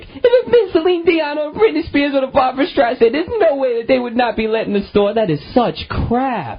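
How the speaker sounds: high, whiny put-on voice of Oprah's pal, slowing at the end; mock indignant friend. Host mocks Oprah's pal quoted in the press, high whiny. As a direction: mimic a huffy girlfriend complaining. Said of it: "her pal, another pal, was like".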